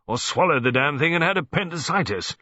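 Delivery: grumbling. A narrator's reading. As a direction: complain as an old colonel. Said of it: "he grumbled".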